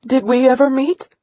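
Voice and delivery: a high, tremulous question from the mother; quivering voice. Acted. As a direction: ask with a shaky catch in the throat. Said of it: "her voice quivering".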